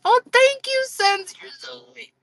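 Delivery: high-pitched, over-sweet squeal; mock-gushing gratitude; gushing thanks. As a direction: gush it way up high, trailing into a tiny squeak. Acting out a gushing thank-you.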